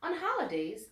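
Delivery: plain reading voice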